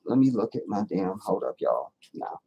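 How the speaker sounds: ordinary talk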